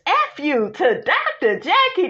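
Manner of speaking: laughing through the quote; can't believe she said it; laughing retelling. Retold or imitated. Retelling Sweet Tea's insult to Jackie, laughing through it. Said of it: "Sweet Tea, honey, says".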